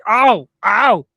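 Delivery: high, loud whiny ow-ow of a dazed victim; hammed-up high yelping pain; mock pained whine. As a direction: play the hurt, confused guy who got whacked. Crowbar victim whining in pain.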